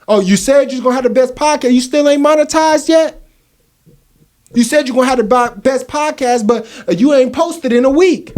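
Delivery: high, loud imitation of hecklers; mocking doubters. People calling out the podcaster's big claim, mocking. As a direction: pitch it up like a nagging critic's taunt.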